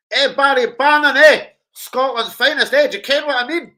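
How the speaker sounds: loud high cheer for the player; excited cheering. Real, not acted. Fan hyping a player's goal, loud and high. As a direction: hype up the player with a loud call.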